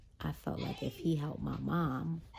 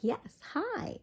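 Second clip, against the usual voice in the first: sugary greeting. Retold or imitated. Sweetly introducing herself to doctor.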